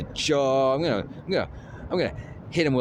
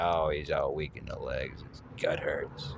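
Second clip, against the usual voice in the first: weak hurt voice; 8 semitones lower, 8 dB quieter than usual. Retold or imitated. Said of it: "He's like".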